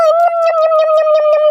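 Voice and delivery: playful "yum-yum" munching noises. Acted. Rabbit eating a tasty carrot in the forest, munching happily. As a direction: gobble it up in rhythmic nom-noms.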